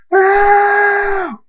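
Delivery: very high, long drawn-out scream; anguished scream. A narrator's reading. Thunderstorm in pain at losing mother, anguished scream. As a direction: scream NO until the breath goes.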